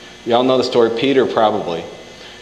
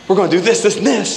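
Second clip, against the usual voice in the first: high eager plan-making burst; excited rush; 8 semitones higher than usual. Retold or imitated. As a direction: pitch it up, all fired up. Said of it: "I get really excited about things".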